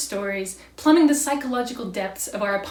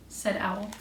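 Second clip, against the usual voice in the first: plain reading voice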